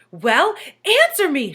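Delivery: high loud sharp demand. A narrator's reading. Teacher towering over Todd, high loud demand. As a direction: bark it at him from above. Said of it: "she demanded".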